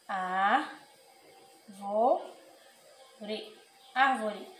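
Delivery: Árvore with a wide open, easy á; open á, relaxed. Shown as a demonstration. Teacher models the acute accent: open relaxed á. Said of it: "você fala bem tranquilo".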